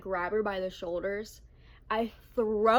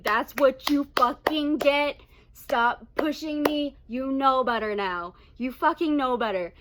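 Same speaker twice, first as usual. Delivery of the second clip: loud, emphatic telling-off; acting out a loud, forceful retort; retold angry scolding; 3 semitones higher, 8 dB louder than usual. Teller scolds the friend who pushed her, retold angry and loud.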